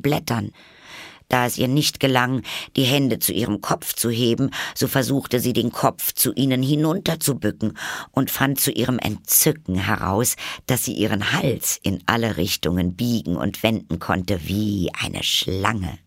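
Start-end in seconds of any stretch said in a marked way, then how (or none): none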